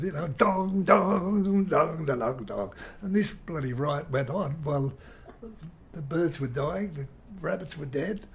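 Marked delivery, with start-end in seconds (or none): 0.3-2.7 loud sung-out dong dong; guitar riff imitation. Musician telling how the band kept playing, loud riff imitation. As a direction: belt the riff like the band won't stop. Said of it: "you can't stop them".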